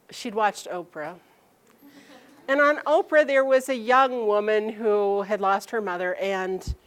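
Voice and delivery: usual speaking voice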